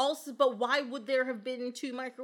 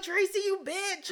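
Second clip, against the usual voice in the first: angry yell; 8 semitones higher, 4 dB louder than usual. Pretend. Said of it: "I'll be standing as they, like, devour you".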